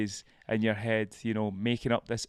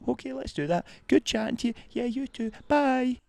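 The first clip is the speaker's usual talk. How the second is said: high, quick, bubbly two-sided self chat, climbing higher; chatty inner voice; 6 semitones higher, 5 dB louder, faster than usual. Pretend. The voice in your head chats to itself about phoning mum, high and chirpy. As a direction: gabble both sides of a silly chat in your head, pitch rising.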